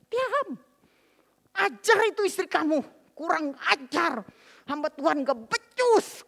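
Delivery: high, loud retold shouting; outraged father-in-law. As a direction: order the son about in outrage, stretching the insults. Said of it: "Rupanya papa masih gak, gak, gak terima".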